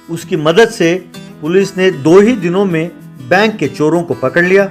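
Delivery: usual speaking voice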